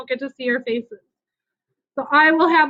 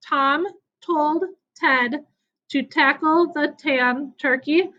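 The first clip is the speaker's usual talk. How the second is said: slowly, word by word, with gaps between; slow demonstration; slower than usual. Shown as a demonstration. Teacher showing pupils a tongue twister, slowly and deliberately. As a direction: say it slowly, separating each word. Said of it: "say it slowly the first time".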